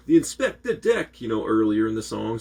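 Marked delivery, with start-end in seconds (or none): 0.1-1.2 mock rapper's signature shout, pitched high. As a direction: call it out high and loud, imitating the record. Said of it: "And then in-, [name]'s like".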